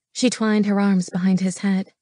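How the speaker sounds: ordinary delivery, nothing marked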